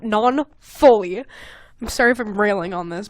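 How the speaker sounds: normal talking voice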